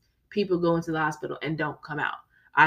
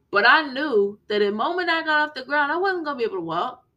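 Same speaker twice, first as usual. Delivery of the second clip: anxious rush; 7 semitones higher, 4 dB louder than usual. Real, not acted. Panicked recollection.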